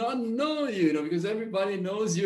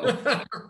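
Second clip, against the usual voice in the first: real laugh, pitched up; 6 semitones higher than usual. Real, not acted. Interviewer reacts to his old teacher's compliment, laughing.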